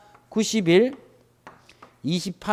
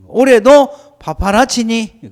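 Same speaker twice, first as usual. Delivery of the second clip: Yanbian accent; 3 semitones higher, 6 dB louder, slower than usual. Shown as a demonstration. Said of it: "작년에도 파파라치니 했다고".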